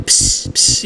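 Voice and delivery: short hissing bursts, like a game cannon; hissed laser-cannon sound effect; imitated cannon hiss. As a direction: mimic the arcade cannon's hiss, twice. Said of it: "the hissing sound from the cannon".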